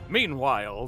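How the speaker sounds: usual speaking voice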